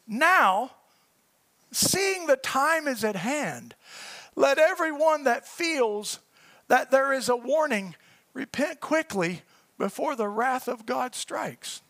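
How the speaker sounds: very slow, weighted reading with pauses; slow reading for effect. Real, not acted. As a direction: let each phrase sink in as you read. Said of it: "I wanted to read 'em slow".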